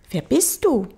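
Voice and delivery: little prince. Acted. Little prince questioning the stranger under the tree, high child voice. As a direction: pitch it up as a curious child.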